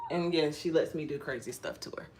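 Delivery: ordinary delivery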